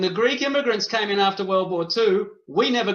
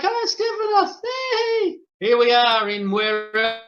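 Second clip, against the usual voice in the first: much higher, gushing hello to a comrade; mock happy greeting; 3 semitones higher than usual. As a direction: play a delighted reunion, pitched way up. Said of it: "and it's like".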